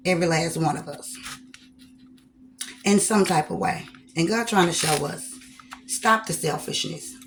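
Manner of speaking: usual speaking voice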